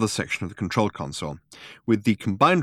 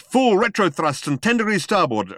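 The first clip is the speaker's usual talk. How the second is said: high, urgent order; raised-voice captain in a crisis; urgent command; 9 semitones higher, 4 dB louder than usual. A narrator's reading. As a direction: call out orders as the ship is attacked. Pilot barking orders in crisis.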